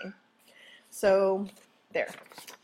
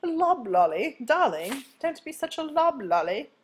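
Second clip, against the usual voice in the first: higher, posh-ish British accent; playful British accent, scolding darling; British accent demo; 4 semitones higher than usual. Shown as a demonstration. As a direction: show the class a hammed-up British accent. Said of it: "Practice saying it with a British accent".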